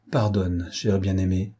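ordinary delivery, nothing marked

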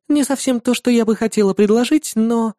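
put-on high bright girlish voice; young woman voice. A narrator's reading. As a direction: voice the demon girl cheerfully. Irda, female character.

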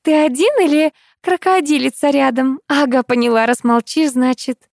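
put-on high, coy girl on the phone; giggly girlish voice. A narrator's reading. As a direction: tease sweetly in a lightly girlish pitch. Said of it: "захихикал девичий голосок". (игривый девичий голосок)

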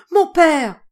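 a sudden, high, loud cry of fright; frightened cry. Acted. Madame d'Abreville cries out in fright to her father. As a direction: call out to your father in alarm. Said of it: "S'écria avec frayeur".

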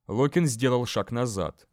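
usual speaking voice

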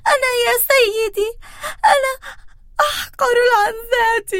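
high, fast, near tears, a tiny goat pleading; trembling voice. Acted. Smallest goat trembling before the troll, crying and high. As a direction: whimper it out like a terrified little goat. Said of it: "بصوت مرتجف".

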